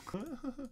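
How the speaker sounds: brief high, soft chuckle; small laugh at oneself; short laugh. Real, not acted. Streamer laughing at using the wrong mic, short soft chuckle.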